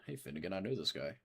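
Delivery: ordinary talk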